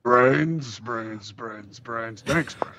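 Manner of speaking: zombie moan fading through a mask. Acted. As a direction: let the zombie groan die away. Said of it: "You can't say anything but brains".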